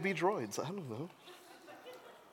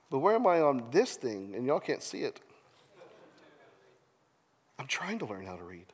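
ordinary talk, nothing marked

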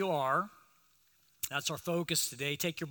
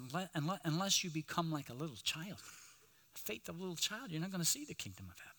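put-on much softer, tender words over a child; Jesus speaking softly while holding a child; 10 dB quieter, faster than usual. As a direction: hush it as if holding a little child.

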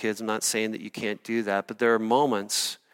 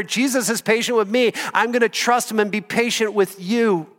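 building from higher to much higher and louder; preaching to yourself; 8 semitones higher, 4 dB louder than usual. Retold or imitated. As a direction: pump yourself up, each line climbing. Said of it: "you're preaching to yourself".

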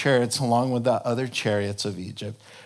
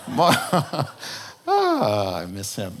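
normal talking voice

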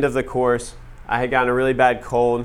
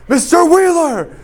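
much higher, much louder bellowed name, slowed; furious yell; 8 dB louder, slower than usual. Retold or imitated. Teacher yelling at sleeping student, full furious yell. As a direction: slam the name down like a fist on the desk.

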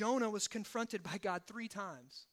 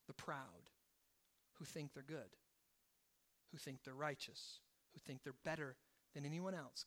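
hushed and solemn; 6 semitones lower, 7 dB quieter than usual. Acted. Solemn hushed scripture moment.

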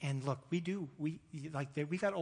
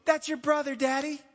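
put-on much higher, louder thrilled child voice; excited little girl; 9 semitones higher, 7 dB louder than usual. Daughter calling to dad during worship, thrilled child. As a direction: bounce with a child's delight. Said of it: "She's like".

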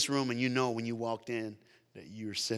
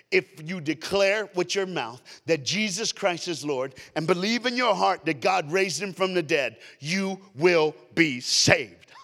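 preached scripture; 6 semitones higher, 8 dB louder than usual. Real, not acted. Preacher quoting a favorite verse to the congregation, proclaiming. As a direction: proclaim it to the room, stretching the final line.

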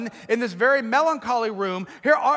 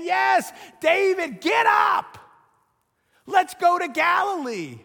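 high, loud, rousing call; preacher voicing Jesus calling his hearers, a loud rousing call; calling out by name; 7 semitones higher, 5 dB louder, slower than usual. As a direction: shout them to their feet by name.

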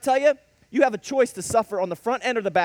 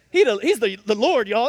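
louder, punchy repeat of the line; mock emphatic; 6 dB louder than usual. Preacher echoing Mister Card's shout to the church. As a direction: hammer it home like the deacon did. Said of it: "How many times did he say that?".

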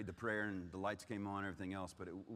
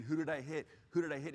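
higher, louder urgent repeated question; retold frantic question; 6 semitones higher, 4 dB louder than usual. Crash survivor to rescuing friend, retold urgent questioning. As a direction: retell an anxious, insistent demand to know.

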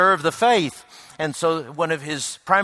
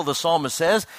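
ordinary talk, nothing marked

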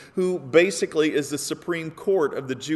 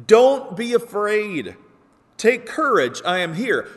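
calling out over the storm; 5 semitones higher, 5 dB louder than usual. Acted. Jesus calls out to frightened disciples in the boat, loud and steady. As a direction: shout comfort across the waves. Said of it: "what Jesus is calling out to the disciples".

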